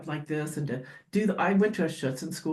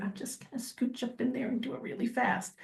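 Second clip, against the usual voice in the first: much softer, a touch higher, sneaky inner voice; sneaky hush; 5 semitones higher, 8 dB quieter than usual. Retold or imitated. Retelling to co-host her sneaky plan, hushed. Sneaking a quick nail clip.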